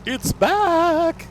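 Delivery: loud, very high squealed announcement; gleeful squeal. Pretend.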